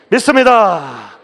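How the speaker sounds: 믿습니다 slow, loud and high; drawn-out shout. Real, not acted. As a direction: stretch out 믿습니다 as a cry. Preacher repeats affirmation. (믿습니다를 길게 높이 외치며)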